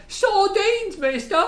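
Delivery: high, loud over-the-top Cockney cry; mock Cockney accent. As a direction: ham up a fake English Cockney shout. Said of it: "her fucking bullshit English accent".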